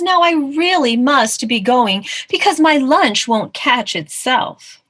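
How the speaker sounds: self-important mouse. Acted. Mouse excusing himself to hunt lunch, loud and self-important. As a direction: take leave grandly and unhurriedly. Voices the mouse taking leave.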